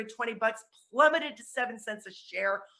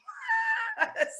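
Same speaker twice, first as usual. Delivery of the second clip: real laugh. Real, not acted. Laughs at the chaotic call.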